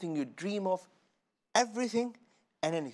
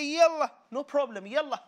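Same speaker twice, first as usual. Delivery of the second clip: higher, louder happy yes; gleeful acceptance; 11 semitones higher, 7 dB louder than usual. Acted. Guest gladly agreeing to another helping, excited. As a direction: cheerfully give in to the food.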